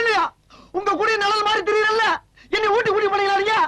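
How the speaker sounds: high-pitched aggrieved protest; quarrelsome complaint. Acted. Hanger-on quarrels about being left behind, quarrelsome complaint. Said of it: "என்கிட்ட எதுக்குடா சண்டைக்கு வர".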